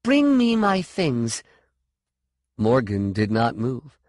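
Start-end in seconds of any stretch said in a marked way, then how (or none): 0.0-1.4 high, slow, deliberate order; raised, insistent command. A narrator's reading. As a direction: order her, stressing each word. Defiant order in story.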